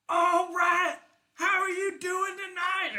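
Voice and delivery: high, loud hype-man shout; goblin emcee. Acted. Goblin emcee shouting to the concert crowd, high and loud. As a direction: pump up the crowd like a rock emcee.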